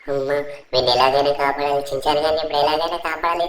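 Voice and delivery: urgent, anxious muttered-to-self rush; frantic Franklin. Acted. Franklin rushes Chinchan to hospital, urgent and upset. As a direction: reassure the injured friend while panicking.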